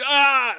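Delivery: sudden loud high "Ah!" like blaring crowd noise; imitated crowd roar. Streamer mimicking glitchy crowd noise in the game, loud sudden roar. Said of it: "and it's like".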